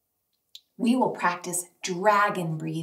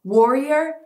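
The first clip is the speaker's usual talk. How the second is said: called out; 4 semitones higher, 7 dB louder than usual. Shown as a demonstration. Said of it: "When I say warrior".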